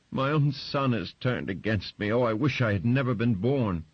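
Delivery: high, loud wailing self-pity; hammed-up lament of the betrayed mother; whimpering self-pity. Mother whimpering that her son turned against her, high and loud. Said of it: "She started to whimper".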